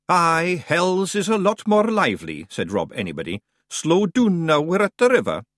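Scottish Feegle voice. A narrator's reading. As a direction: play the cocky little Scot. Rob Anybody voiced in Scots.

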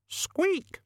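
very high tiny squeak; rat squeak. Acted. Death of Rats squeaks.

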